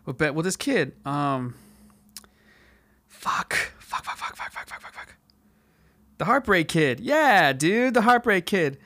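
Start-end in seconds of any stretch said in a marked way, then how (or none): 3.1-5.2 muttered under the breath, fading away; whispered swearing. Real, not acted. Racking his memory under his breath. 7.0-8.8 high, drawn-out burst of recognition; excited recall. Real, not acted. Suddenly remembers the film title.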